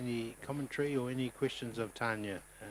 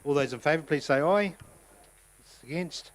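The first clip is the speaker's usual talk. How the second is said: loud, raised chair's call; calling out the vote; 5 semitones higher, 12 dB louder than usual. Real, not acted. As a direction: project the call to the room.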